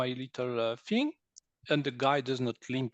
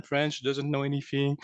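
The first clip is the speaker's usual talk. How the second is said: usual speaking voice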